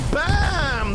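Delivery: loud, high "Bam!"; excited shout. Real, not acted. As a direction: shout the hit as it lands. Rocket hit called out.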